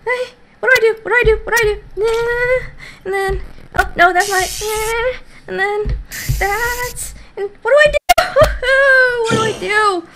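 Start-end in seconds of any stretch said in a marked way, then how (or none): 0.1-2.6 real panic. Real, not acted. Gamer panics as the surgery goes wrong, high frantic cries. 7.3-10.1 panicked cry. Real, not acted. Panicking at surgery.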